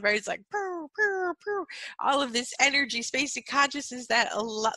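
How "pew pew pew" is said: high-pitched "pew" sounds; firework noises. Retold or imitated. Speaker showing fireworks going off in her field, high pew noises. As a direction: imitate fireworks popping off, high and loud. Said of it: "it's like fireworks going off".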